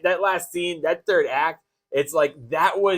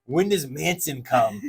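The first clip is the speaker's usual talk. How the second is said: slow, lightly voiced parent impression; imitating impatient parents. Parents asking during the movie, slow mock impatience. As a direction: draw it out as a mock-pestering question. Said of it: "my mom and dad kept going".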